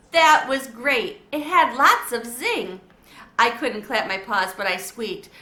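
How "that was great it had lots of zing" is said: slow, loud, enthusiastic praise; excited praise. A narrator's reading. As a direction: cheer him on warmly and loudly. Rosie praises after clapping.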